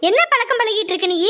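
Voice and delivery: usual speaking voice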